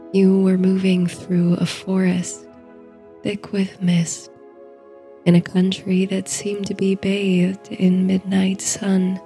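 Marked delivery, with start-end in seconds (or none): none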